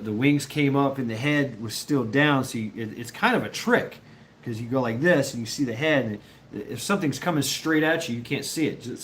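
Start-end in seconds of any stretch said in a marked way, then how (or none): none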